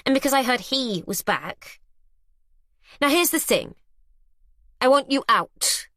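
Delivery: cold demand. Acted. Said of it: "You heard".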